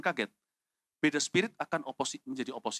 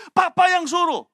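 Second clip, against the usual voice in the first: very high, loud outburst; indignant shout; 14 dB louder, slower than usual. Acted. Younger brother blaming father's orders, high indignant shout. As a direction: yell your excuse in protest. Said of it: "mestinya kan gini".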